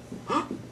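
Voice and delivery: quick unvoiced gasp of surprise; sharp gasp. A narrator's reading. Narrator gasps as the rug turns magic. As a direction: gasp as if seeing something amazing. Sudden discovery in story.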